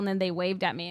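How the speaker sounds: ordinary delivery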